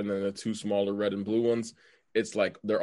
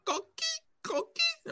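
coqui frog call; 6 dB quieter, slower than usual. Retold or imitated. Imitating the frog's call.

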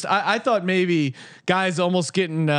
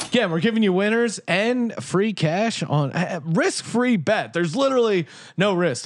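ad read; 4 semitones lower than usual. Acted. Host reading the WynnBET sponsor spot to listeners, steady ad-read voice. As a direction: sell it like a sportsbook ad.

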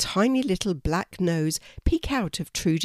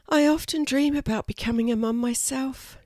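wistful sigh; 7 semitones higher than usual. A narrator's reading. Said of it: "she sighed".

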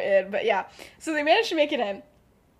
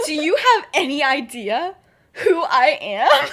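slow, loud, high mock outrage; retold indignant bluff; 5 semitones higher, 8 dB louder than usual. James bluffs a security guard, mock indignant outrage. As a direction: play hammed-up self-importance through laughter.

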